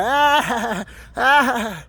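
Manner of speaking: imitated jeering laugh, pitched high; mocking laugh. Imitating people pointing and laughing at the dreamer, mocking. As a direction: draw out a high "ah" into a mocking cackle. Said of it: "like in a mocking laugh".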